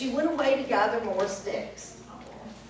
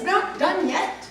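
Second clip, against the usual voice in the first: surprised; 6 semitones higher than usual. Acted. Witch surprised and impatient.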